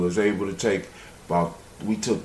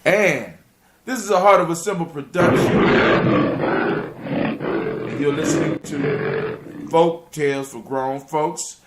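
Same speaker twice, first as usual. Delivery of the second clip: big, slow, rising announcer voice building to a loud high finish; radio announcer tagline; 8 semitones higher, 5 dB louder, slower than usual. Acted. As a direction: sell the show name like a station ident. Radio show sign-off tagline.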